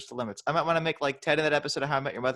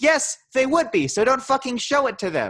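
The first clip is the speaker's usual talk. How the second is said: exasperated retort; 10 semitones higher, 4 dB louder than usual. Retold or imitated. Mocking an obvious reply.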